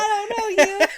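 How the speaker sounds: very loud, high-pitched mock shriek; Bobby Hill. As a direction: shriek it in cartoon panic, half laughing. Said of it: "give her the old Bobby Hill".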